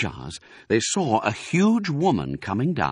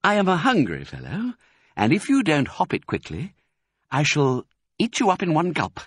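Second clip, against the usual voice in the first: slightly raised, measured menace; mock-polite threat; 7 semitones higher than usual. A narrator's reading. Fox warns Rat to hop it, politely menacing. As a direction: threaten him with silky courtesy.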